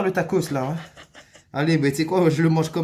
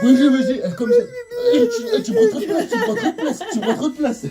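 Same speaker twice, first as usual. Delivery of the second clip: laughing; 7 dB louder than usual. Real, not acted. Laughing, squealing while they work.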